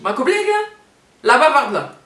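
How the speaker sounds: high, loud indignant echo; loud indignant disbelief; indignant retort. Retold or imitated. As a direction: fire it back in disbelief.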